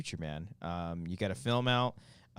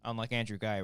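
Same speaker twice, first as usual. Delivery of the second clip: normal talking voice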